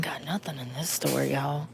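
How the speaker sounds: frustrated whisper in the aisle; hushed grumble to viewers. Real, not acted. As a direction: complain under your breath. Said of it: "whispering in store".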